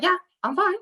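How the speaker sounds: high-pitched put-upon bartender retort; hammed-up overwhelmed reply; mock exasperation. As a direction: act out a swamped bartender answering back, voice pushed up high. Acted reply to customers when swamped.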